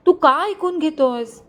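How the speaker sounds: put-on a young woman pleading at her own wedding, voice thick with tears; tearful pleading. A narrator's reading. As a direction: beg him through tears, slowly. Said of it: "ती रडत त्याच्याशी बोलण्याचा प्रयत्न".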